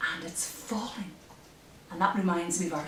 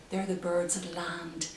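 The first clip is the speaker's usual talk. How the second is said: ordinary delivery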